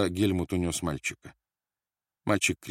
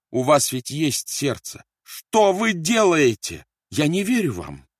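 high, loud, drawn-out plea; pleading; 9 semitones higher, 7 dB louder, slower than usual. A narrator's reading. Mother on the floor begs her tormentor, pleading. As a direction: implore desperately, stretching the words. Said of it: "умоляя".

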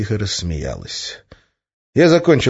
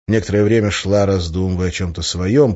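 usual speaking voice

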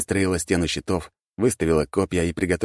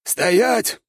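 high sharp barked command; old king barking; 4 dB louder than usual. A narrator's reading. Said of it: "рявкнул старый король".

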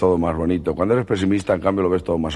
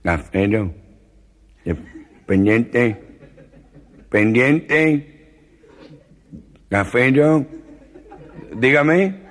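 a lightly nasal, timid put-on voice; hesitant nasal customer; mild comic nasal voice; gangoso voice; 3 semitones higher, much slower than usual. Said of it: "el gangoso que entraba muy tímido".

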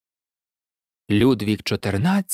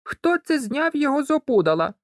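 high-pitched mild indignation; indignant; 11 semitones higher than usual. A narrator's reading. Girl sees the hat off the scarecrow, mildly indignant. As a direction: raise the pitch in affront, not the volume. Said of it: "обурилася Малот".

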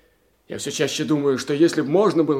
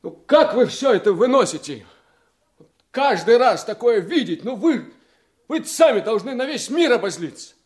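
higher, louder mock-indignant question; quoting a woman acquaintance; 8 semitones higher, 6 dB louder than usual. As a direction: play her pressing, disbelieving tone. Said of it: "одна знакомая спросила".

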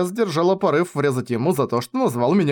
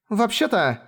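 put-on high, less cocky boy's voice; hesitant boy; 8 semitones higher, slower than usual. A narrator's reading. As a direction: begin timidly as a young boy. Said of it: "он заикался, был куда менее нахален".

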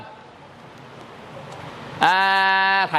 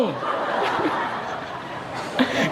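soft airy laugh; 4 semitones lower, 6 dB quieter than usual. Real, not acted. Punchline airport story.

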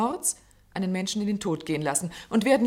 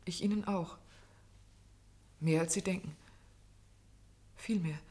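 soft confession; 2 semitones lower, 7 dB quieter than usual. Acted. Confessing affection to the doctor.

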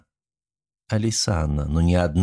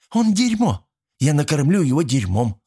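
put-on high, ravenous young fury; bloodthirsty; 7 semitones higher than usual. A narrator's reading. Alisanna hungry to punish the prisoner, bloodthirsty. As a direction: spit it out with savage relish. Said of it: "голос был слишком плотоядным".

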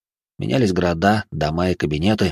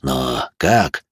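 low, slow, puzzled question; understated surprise, drawn out; surprised; 5 semitones lower, much slower than usual. A narrator's reading. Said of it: "удивился иной".

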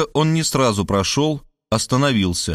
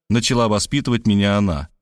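ordinary delivery

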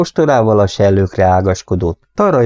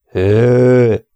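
louder short whoa; mild call to the horses; 4 semitones higher, 4 dB louder than usual. Acted. Bob calling to his horses to stop, a moderate cry. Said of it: "kiáltotta Bobapó".

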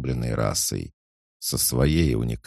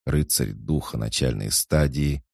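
usual speaking voice

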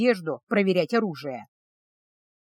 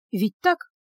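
quick inward check with rising tune; mild self-doubt. A narrator's reading. Healer reassures herself about the meat, mildly unsure. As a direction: ask yourself, a little unsure. Heroine doubting her own reasoning.

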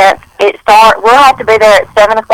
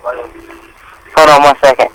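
phone speaker; 6 semitones lower, much slower than usual. Real, not acted. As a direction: voice through a speakerphone. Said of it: "you're on speaker".

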